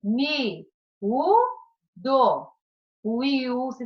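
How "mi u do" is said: Mi, u, do, each syllable alone with pauses; split into syllables. Shown as a demonstration. Teacher separating miúdo for the class, syllable by syllable. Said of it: "como separa?".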